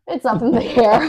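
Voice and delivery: loud, gleeful echo of the co-host; excited echo. Real, not acted. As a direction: repeat the quip with a burst of glee. Echoing the co-host's quip.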